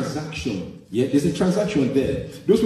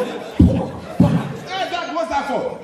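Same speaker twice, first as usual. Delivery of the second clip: put-on startled kids; 11 semitones higher, much slower than usual. Kids asking dad about beating.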